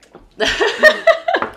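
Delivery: big high burst of laughter after the shot; loud laugh. Real, not acted. Host laughing loudly after the toast with her guest. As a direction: crack up loudly, high and bright.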